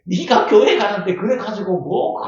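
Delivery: loud, high-pitched teasing; mocking taunt. Scoffer teasing a churchgoer in trouble, mocking. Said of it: "조롱을 하고 비방을 하고 놀린 거예요".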